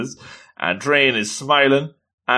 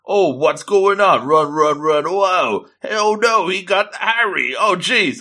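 high, flustered play-acted panic; hammed-up alarm, pitched up; mock panic; 6 semitones higher than usual. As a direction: act out the chaos of a getaway. Acting out smoke-screen panic and chase.

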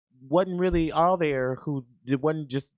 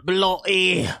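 British exclamation; 3 semitones higher, 6 dB louder than usual. Retold or imitated. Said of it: "I used to scream that".

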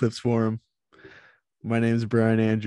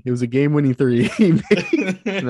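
laughing; 4 semitones higher, 3 dB louder than usual. Real, not acted. Laughing while retelling.

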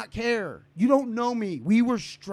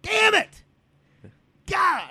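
frustrated shout; 12 semitones higher, 4 dB louder, much slower than usual. Real, not acted. Frustrated outburst at interruption.

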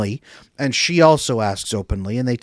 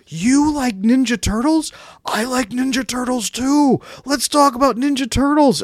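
put-on much higher, slow, eager child voice; a playground kid gushing about a shared love; excited little kid; 12 semitones higher, 4 dB louder than usual. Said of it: "the way a five-year-old who finds someone on the playground says".

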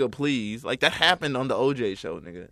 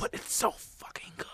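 breathy whisper into the mic; asmr whisper; 9 dB quieter than usual. Acted. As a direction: whisper it close and hushed. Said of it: "Stop whispering into the microphone".

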